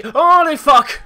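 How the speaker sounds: high, loud startled yell; terrified scream. Real, not acted. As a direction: shriek in fright. Jump scare in game.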